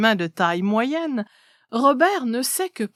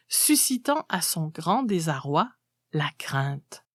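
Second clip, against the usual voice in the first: ordinary delivery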